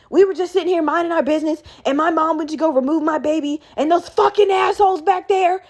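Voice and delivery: high, rushed retold rant; mock shouting. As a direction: imitate an outraged mom, pitch climbing. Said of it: "is shouting and explaining it".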